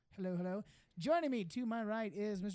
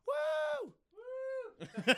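high, shrill whoops pretending to be a crowd; fake audience cheer; 3 dB louder than usual. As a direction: cheer like a whole studio audience.

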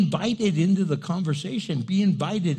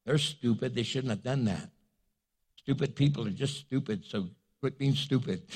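mock careless voice, much softer and dropped low; apathetic shrug; 5 semitones lower, 5 dB quieter than usual. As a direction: toss it off like someone who couldn't care less. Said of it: "Apathy is where you just don't care anymore".

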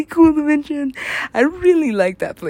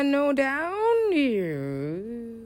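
very slow, soft, stretched out; long trailing imitation; imitated wonder; 9 dB quieter, much slower than usual. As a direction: draw each word way out, softly. Imitating the woman looking around.

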